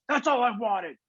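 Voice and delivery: high-pitched mock coach yell; retold coach's outburst. Coach answers the player who grabbed him, mock outburst. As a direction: imitate the coach's emphatic comeback, pitched high.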